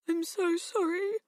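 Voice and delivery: a touch of tears; tearful. A narrator's reading. Girl apologising to her parents, mildly tearful.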